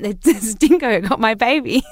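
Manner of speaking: hammed-up dramatic quote; mock Lindy quote. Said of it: "She's like".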